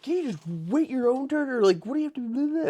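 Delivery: odd, high-pitched mock complaint; weird mimicking voice. Stepmom telling him to wait his turn, weird high voice. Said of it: "She says it in a weird way".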